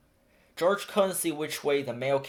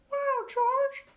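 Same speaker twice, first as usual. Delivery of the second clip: very high, bright woman voice; mail carrier. A narrator's reading. Mail carrier thrilled to get a card, very high. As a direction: squeal with delight. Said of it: "said the mail carrier".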